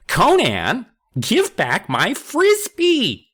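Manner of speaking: high, slow, loud whine. A narrator's reading. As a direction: whine it out like a nerdy kid. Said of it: "Kermit whined".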